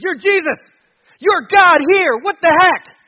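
high, loud panicked demon cry; demons shrieking. Retold or imitated. Demons crying out at Jesus, loud and high. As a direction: yell it like a terrified demon caught out.

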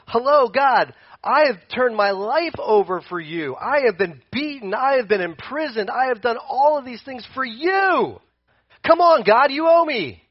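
acted desperate protest. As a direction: plead your case loudly, climbing in pitch. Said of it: "And Paul's probably going".

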